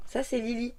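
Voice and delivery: high cooing voice for the cat; pet talk. Real, not acted.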